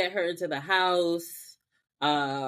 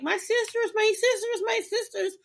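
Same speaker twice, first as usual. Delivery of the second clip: high, squealing mock indignation; hammed-up high villain; Tara's outrage; 10 semitones higher, faster than usual. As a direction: whine it like a cheated villain. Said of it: "the way this bitch was like".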